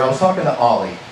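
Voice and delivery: ordinary talk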